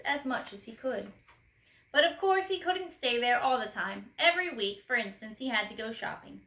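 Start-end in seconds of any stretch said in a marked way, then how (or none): none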